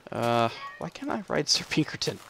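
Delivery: ordinary talk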